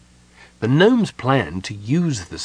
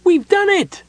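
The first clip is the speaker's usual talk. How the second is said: very high, loud cry of triumph; ecstatic shout; 6 dB louder than usual. A narrator's reading. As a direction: shout it out in sheer joy. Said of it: "the gnome shouted ecstatically".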